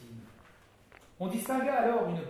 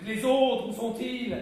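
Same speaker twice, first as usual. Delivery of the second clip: raised, louder impatient question; impatient demand; 6 semitones higher, 8 dB louder, slower than usual. Acted. Duke impatiently demanding where the others are.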